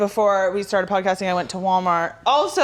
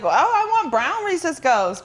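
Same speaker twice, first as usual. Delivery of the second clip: mock plea; 7 semitones higher than usual. Joking mock plea for brown ghosts.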